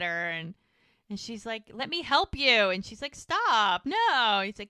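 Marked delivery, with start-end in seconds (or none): 1.7-2.7 raised, loud retold plea; insistent offer. Acted tussle, offering help. 3.1-4.4 loud, raised, drawn-out mock refusal; playful protest. Acted playful refusal while tussling.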